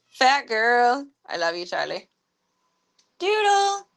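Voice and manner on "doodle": coaxing the cat in a very high sing-song voice; high pet call. Real, not acted. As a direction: call sweetly and high, like to a kitten. Calling the cat.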